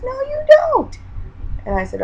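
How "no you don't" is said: much higher, louder mock-shrill refusal; the inner voice shrieks its denial. Arguing back with inner voice.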